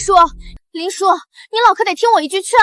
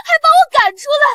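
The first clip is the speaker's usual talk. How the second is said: fast, choked crying complaint; wailing sob; 5 semitones higher, faster than usual. Acted. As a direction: cry it out to the crowd. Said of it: "这女人哭得这么惨". (哭喊着控诉被赶出家门)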